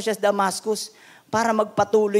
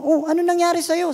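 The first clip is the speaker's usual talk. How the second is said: surprised pastor; 10 semitones higher than usual. Retold or imitated. Pastor startled by bloodied neighbour, surprised. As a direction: gasp it out, startled at the door. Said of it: "Sabi nung pastor".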